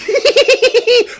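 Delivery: high, loud eerie cackle; a spooky giggle from the dark; ghostly laugh. Acted. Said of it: "that little laugh going".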